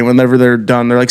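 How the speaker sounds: ordinary delivery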